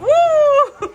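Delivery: high, loud "woo"; cheering whoop. Real, not acted. Parent celebrating child's slide, cheering whoop.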